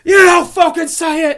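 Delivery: high, loud, drawn-out yell; hammed-up screaming rage; mock wigging out. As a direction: blow up like a furious chef. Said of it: "he wigs out on him".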